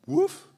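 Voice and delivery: a sharp, high, loud woof; a loud yapping woof from the dog in the joke; high loud bark. Retold or imitated. Dog answers eagerly in joke.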